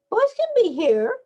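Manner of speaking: put-on high defiant little-girl voice; child's voice countering. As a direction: push back like a defiant kid. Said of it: "countered Alicia".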